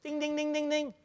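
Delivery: high, soft dinging placeholder; mock bell sound. Trainer mimicking a feeler's answer, high bell ding. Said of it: "I feel we should".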